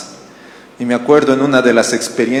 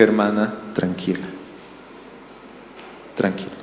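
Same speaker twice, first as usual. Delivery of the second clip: soothing calm; 3 semitones lower, 11 dB quieter than usual. Retold or imitated. Calming an angry teacher.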